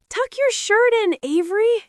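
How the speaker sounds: high, scolding mother voice. A narrator's reading. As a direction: call out like a fussing mother. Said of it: "cried Missus Zuckerman".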